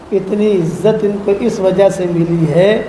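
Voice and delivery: slow, low quoted answer; measured, drawn-out low delivery; solemn reveal. Retold or imitated. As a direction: give the answer slowly, low and deliberate. Angel's answer revealed in story.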